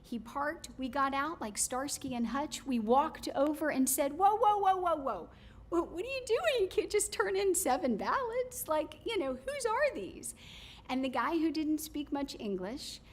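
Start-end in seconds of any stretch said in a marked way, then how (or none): 4.1-10.3 high, loud startled whoa whoa; retold confrontation at the drop box, high and alarmed; alarmed challenge. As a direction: stop him mid-act with alarm. Confronting man at ballot box.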